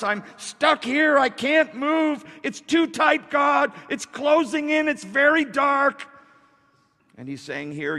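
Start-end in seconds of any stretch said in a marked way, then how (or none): 0.0-6.1 high, slow cries of a trapped person; shouted distress. Acted. Preacher as someone stuck in narrow place, shouting in distress. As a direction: cry out to God as if hemmed in. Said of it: "full of trouble and distress".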